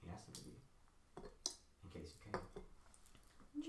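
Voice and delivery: very soft, slow near-whisper; hushed unmasked stranger. Acted. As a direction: let the words out slowly, barely voiced. GM voices the unmasked man.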